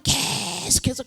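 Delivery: 계속해서 with a very long first syllable; drawn-out emphasis. Acted. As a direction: stretch the 계 in 계속해서. Stretches word to show endless repetition.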